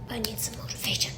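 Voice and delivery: unvoiced, confiding whisper; whispered aside. Runner asking viewers to doubt her, whispered plea. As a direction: drop suddenly into a whispered plea. Aside confessing need for motivation.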